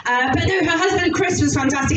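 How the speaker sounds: normal talking voice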